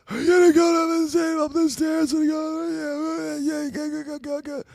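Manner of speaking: very high, sustained squealing voice; imitating guys' voice. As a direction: mimic that voice in an exaggerated high falsetto. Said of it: "guys that have that voice. They're like".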